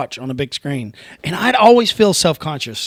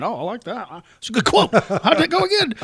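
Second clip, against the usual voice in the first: high-pitched, rising parody of an eager follower; mock eager disciple; 6 semitones higher than usual. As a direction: play it for laughs, pitch climbing to the last question. Mockingly acts out Matthew quoting Jesus.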